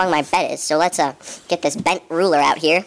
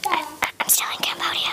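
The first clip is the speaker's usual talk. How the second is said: hushed whisper; breathy secret whisper; 12 semitones higher, 6 dB quieter than usual. Acted. Sudden whisper, unvoiced.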